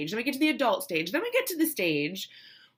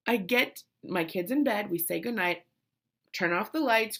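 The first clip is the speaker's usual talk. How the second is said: normal talking voice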